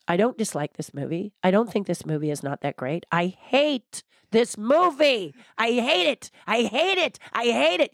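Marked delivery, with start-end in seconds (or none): 3.1-5.3 high, drawn-out emphatic outburst; passionate hatred. Acted. Woman showing husband how passionately she hates a movie, vehement. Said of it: "and it's passionate". 5.6-7.9 high, repeated vehement outburst; passionate hatred. Acted. Woman showing husband how much she hates a movie, vehement. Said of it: "and it's passionate".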